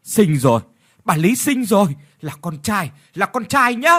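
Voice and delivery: midwife's excited call; woman calling out. Acted. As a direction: shout the good news. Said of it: "một người đàn bà la lên".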